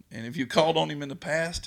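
rising preaching. Acted. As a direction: lift the congregation with your voice. Preacher building to appeal.

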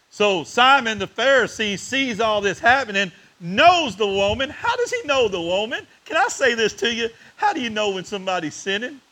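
fervent preacher building up; preaching at full force. Acted. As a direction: press each line home to the church. Preaching climax on Simon.

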